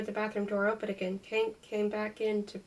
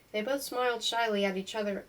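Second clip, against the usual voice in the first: ordinary delivery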